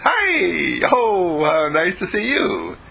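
a playful pup greeting his master; bouncy, eager puppy hello; happy dog voice. Acted. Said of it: "and he says".

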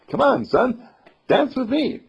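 put-on high, excited mouse calling the boy; lively church mouse. Church mouse asks the little boy to dance, lively high voice. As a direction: call out brightly to a dance partner.

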